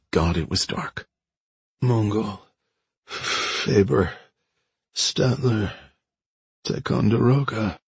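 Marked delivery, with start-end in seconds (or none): 1.8-7.8 slow, halting mumble between pauses; dazed mumble. A narrator's reading. Wounded woman drifting in and out, dazed mumble. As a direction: mumble odd words while half conscious. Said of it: "Nola mumbled".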